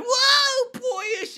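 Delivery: very high, squeaky mock kid voice; mock boyish whoa. Mocking kids nowadays in a high squeaky voice. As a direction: mimic a flailing kid.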